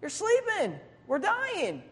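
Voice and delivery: high, fast panicked yelp; panicked disciples. Retold or imitated. Disciples wake sleeping Jesus in the storm, high panicked yelp. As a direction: squeal it like terrified friends waking someone. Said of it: "and they say".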